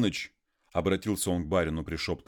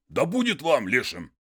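higher, louder brush-off to the jeering men; calling out; 8 semitones higher, 5 dB louder than usual. Acted. Konstantin snaps back at the laughing peasants, raised and loud. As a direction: shoo them off with a shout.

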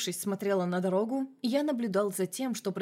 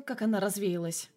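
ordinary delivery, nothing marked

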